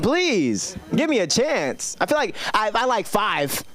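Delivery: ordinary talk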